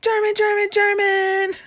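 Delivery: hammed-up shouting stand-in for German; mock German yelling. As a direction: imitate the parents yelling down the phone. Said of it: "start yelling in German".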